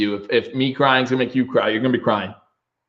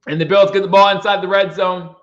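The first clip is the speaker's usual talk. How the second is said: play-by-play call; 7 semitones higher, 3 dB louder than usual. Acted. Fan streamer calls the game.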